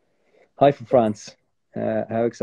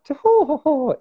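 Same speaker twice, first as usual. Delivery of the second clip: high, loud hammed-up "ho, ho, ho"; mock foreign-TV voice; 4 dB louder, slower than usual. Actor mimicking people seen on TV, high mocking impression. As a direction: imitate people on TV, pitched way up. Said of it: "people that sound like that".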